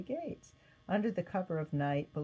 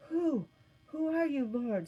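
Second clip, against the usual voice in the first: frightened stammer, voice jumping high; 9 semitones higher than usual. Acted. Saul on the ground, stammering up at the light in fear. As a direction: stumble over the first word in shock. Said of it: "Saul stammered".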